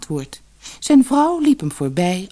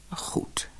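toegevend gefluisterd; 13 dB quieter than usual. Acted. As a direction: geef zuchtend toe. Visser geeft toe. (zacht gefluisterd)

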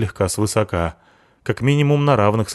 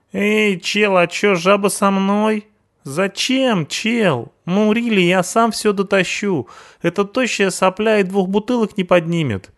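hoog, rauw punkersstemmetje, spottend; punk sidekick voice; 12 semitones higher than usual. Acted. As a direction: speel de brutale punker, veel hoger.

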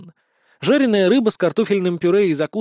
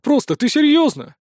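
high astonished question, pitched up; surprised; 10 semitones higher, 4 dB louder than usual. A narrator's reading. Sisyulya astonished at the stolen lunch, high and surprised. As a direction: jump up in disbelief. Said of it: "удивился Сисюля".

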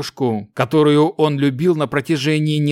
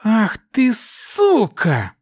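hissing; 8 semitones higher, much slower than usual. A narrator's reading. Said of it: "прошипела Аяса".

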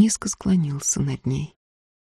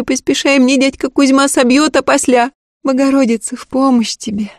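put-on high, eager young servant girl; peasant girl voice; 7 semitones higher than usual. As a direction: pitch it up as a simple village girl. Girl blesses him.

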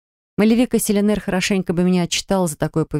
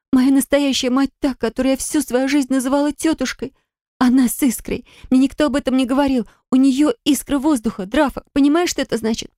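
high, urgent, close to sobbing; tearful pleading; 6 semitones higher than usual. Acted. Heroine begs the healer to send her back, tearful. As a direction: beg through tears for help. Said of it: "Я всхлипнула".

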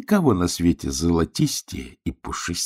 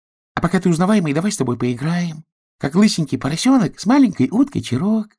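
little duck voice; 10 semitones higher, 3 dB louder than usual. Acted. Duck voiced, high and quick.

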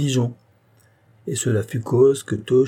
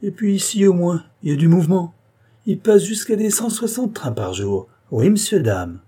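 high, loud, fast and proud; cheerful boast about the trains; old concierge bragging, unaware; chatty concierge; 7 semitones higher, 4 dB louder than usual. Acted.

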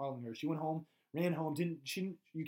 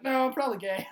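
hammed-up confession; 9 semitones higher, 9 dB louder than usual. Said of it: "And Joe's like".

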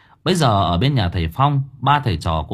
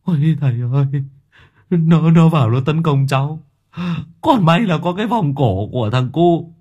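terrified pleading; 10 semitones higher than usual. Acted. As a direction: blurt it out in panic. Terrified old man begging master.

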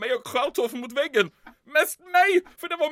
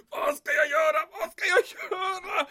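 desperate; 7 semitones higher than usual. Acted. Desperate panic.

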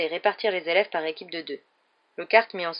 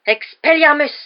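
much louder and higher, hurled out; cried spell; 9 semitones higher, 12 dB louder, slower than usual. Acted. Rogue casting at Lockhart, crying out. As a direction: fire the spell like a blow. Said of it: "s'écria Rogue".

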